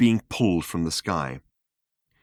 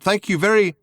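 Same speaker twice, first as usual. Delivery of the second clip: louder, higher call, cut off mid-word; calling out again; 11 semitones higher, 5 dB louder than usual. Acted. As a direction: shout thanks until the thunder cuts you off. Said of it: "he called out".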